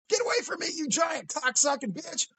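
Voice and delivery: high-pitched mock kid yell; high, protesting mock holler. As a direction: imitate a caught kid cursing. Caught kid yells while fleeing.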